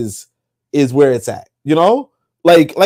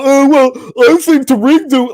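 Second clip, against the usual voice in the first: higher put-on voice parodying critics; mock the armchair critics in a higher voice; higher, sneering impression of the peanut gallery; mock smug voice; 9 semitones higher than usual. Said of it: "And then people sit down".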